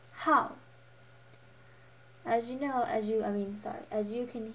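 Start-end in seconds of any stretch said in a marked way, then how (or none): 0.1-0.5 "how" loud, falling sharply from high; fourth tone. Shown as a demonstration. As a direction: drop "how" firmly. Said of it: "pronunciation tones".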